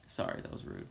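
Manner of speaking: hushed apologetic whisper; whispered aside. Real, not acted. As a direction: breathe it out as a guilty aside. Guilty aside after mocking.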